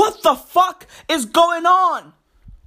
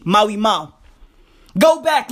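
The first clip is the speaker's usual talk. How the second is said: normal talking voice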